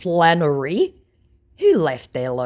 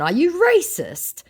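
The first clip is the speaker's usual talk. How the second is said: mock accusation; 5 dB louder, slower than usual. Narrator mock-accusing scammer.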